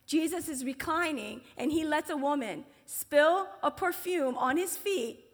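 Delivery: ordinary talk, nothing marked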